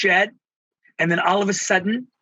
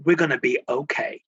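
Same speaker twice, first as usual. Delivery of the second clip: reassuring partner; 6 semitones lower, 3 dB quieter, faster than usual. Retold or imitated. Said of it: "I looked at my beautiful partner and I said".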